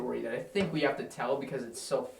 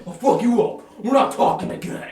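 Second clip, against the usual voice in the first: mock anger; 6 semitones higher, 8 dB louder than usual. Imitating friends' fake anger.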